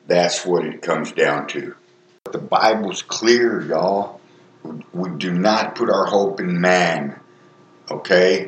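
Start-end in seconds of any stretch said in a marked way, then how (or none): none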